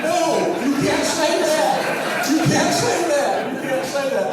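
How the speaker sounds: high, loud, slow protest; mock panic, pleading no; loud, high alarmed repeats, fading as he laughs; alarmed inner voice. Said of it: "And I'm thinking".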